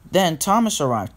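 ordinary delivery

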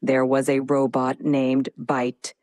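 low, dramatic robot voice. Acted. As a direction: speak like a dramatic machine. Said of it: "in a robotic voice".

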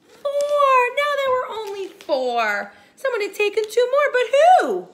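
high and loud, very fast; dramatic alarm. Acted. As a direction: build the suspense up to the question. Dramatic reading, rising alarm.

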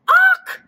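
loud, high hen squawk; startled squawk. Acted. Henny Penny bumped into, startled squawk. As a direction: squawk on impact.

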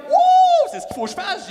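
high, loud whoop then shout; overexcited. Retold or imitated. Younger self shouting his sudden idea, loud and high. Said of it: "J'étais comme".

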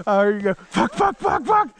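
high, panicked mock swearing; high mock panic, retelling friend's fumble. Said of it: "You were like".

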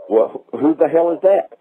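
ordinary talk, nothing marked

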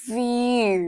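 "feel" stretched slowly as fff-yoooo; drawn-out y glide. Shown as a demonstration. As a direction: draw out "feel" to expose the y before oo. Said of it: "feel แบบนี้". (ลากเสียง "feel" ช้าๆ เป็น ฟ-ยู)

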